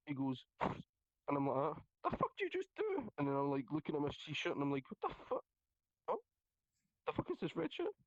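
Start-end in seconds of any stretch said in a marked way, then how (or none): 1.6-3.1 high startled cry, reliving the moment; retold shock. Stab victim reenacting his shock to friends, high startled yelp. 4.9-7.9 high, stunned questioning; retold disbelief. Stab victim reenacting spotting blood, high stunned squeak.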